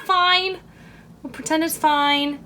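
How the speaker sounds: ordinary talk, nothing marked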